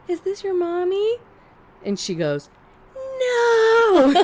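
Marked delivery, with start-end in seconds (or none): 0.1-1.2 put-on high, singsong kid-directed question; talking to a child. Gently questioning a small lost child. 3.0-4.1 high, loud mock shriek of a little kid; imitated child's scream. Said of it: "She, like, screams".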